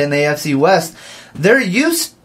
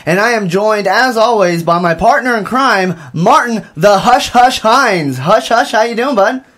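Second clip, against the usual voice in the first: bright, higher announcer voice opening the show; radio host; 5 semitones higher than usual. Acted. Host greeting the audience on campus radio, upbeat announcer read. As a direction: welcome the listeners like a live radio host.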